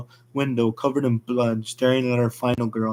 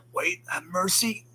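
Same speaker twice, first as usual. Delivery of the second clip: higher, softer, slow frightened plea; begging for mercy; 6 semitones higher, 6 dB quieter than usual. Acted. Dying firefighter pleads with the rabbit, soft frightened plea. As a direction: beg for your life in a thin voice. Firefighter begs the axe killer.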